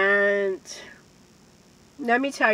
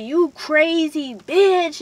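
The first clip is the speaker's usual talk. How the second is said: mock angry yell; 6 semitones higher, 4 dB louder, slower than usual. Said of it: "he was like".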